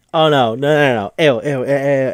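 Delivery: rapid repeated squeals of mock horror; freaking out. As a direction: act out a disgusted freak-out. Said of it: "It's just freaking out".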